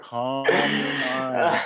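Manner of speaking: very slow drawn-out mantra. Pretend. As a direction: stretch every word like a guru.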